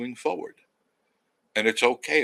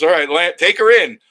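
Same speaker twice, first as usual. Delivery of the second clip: dad calling out; 7 semitones higher, 14 dB louder than usual. Retold or imitated. Dad voiced telling him to land.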